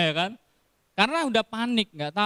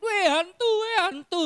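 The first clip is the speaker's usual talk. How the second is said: frightened cry; 13 semitones higher than usual. Retold or imitated. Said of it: "berteriak-teriak karena takut".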